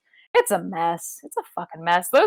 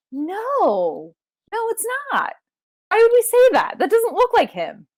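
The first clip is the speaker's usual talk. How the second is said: much higher, squeaky protest; mock denial; 13 semitones higher than usual. Podcaster retelling own reaction, high and baffled. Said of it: "And I was like".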